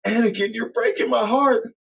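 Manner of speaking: high and hurt, near tears; hammed-up high heartbroken wife; tearful Padme. Padme line.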